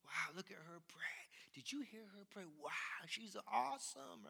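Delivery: hushed, awed onlookers whispering; onlookers impressed. Retold or imitated. Churchgoers murmuring in awe at a prayer. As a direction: gush quietly over the praying person. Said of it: "they're like".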